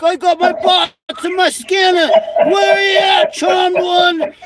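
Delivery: high shouting caricature; hammed-up screeching rant; shouted mock impression. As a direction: yell it like a frantic crank. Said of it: "He's like".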